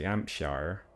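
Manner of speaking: Hampshire said "Ampshar" in a farmer accent, H dropped; slow farmer-accented HAMPSHIRE with no H; farmery Hampshire accent. Shown as a demonstration. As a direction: drawl Hampshire like a West Country farmer, r sounded at the end. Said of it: "that's quite sort of farmery".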